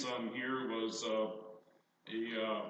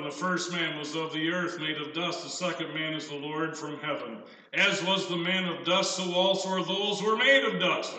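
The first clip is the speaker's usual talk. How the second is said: measured pulpit reading of the verse; scripture reading; 4 semitones higher, 8 dB louder than usual. Acted. Preacher reading the Bible passage aloud, measured. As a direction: lay each phrase out for the congregation.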